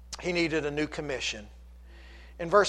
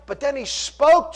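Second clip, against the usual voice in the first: high, emphatic pulpit delivery; impassioned preaching; 9 semitones higher, 7 dB louder than usual. Acted. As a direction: build the scripture drama with force. Preaching at full force.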